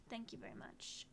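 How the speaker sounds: a soft whisper; whispered thanks. Real, not acted. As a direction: breathe the thanks out quietly.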